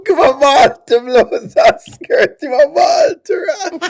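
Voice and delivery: high giggling through the words; can barely get it out for laughing; laughing hard. Real, not acted. Streamer cracking up at a breakup clip, high giggling.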